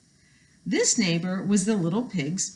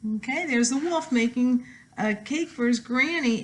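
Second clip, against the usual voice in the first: usual speaking voice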